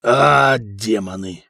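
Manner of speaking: low growl with a loud first burst; gruff warrior's growled oath. A narrator's reading. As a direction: growl the curse, dropping in pitch. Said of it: "прорычал Сигурд".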